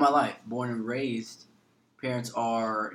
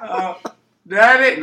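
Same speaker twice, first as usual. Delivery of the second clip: loud, higher mock cry for daddy; mock wail; 7 semitones higher, 13 dB louder, much slower than usual. Joking about his absent father, a loud mock wail. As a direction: wail it in jest.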